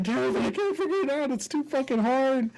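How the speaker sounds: high-pitched mock wail of a frustrated kid; bawling child. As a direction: imitate a six-year-old bawling over homework. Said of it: "I'm fucking bawling at this point".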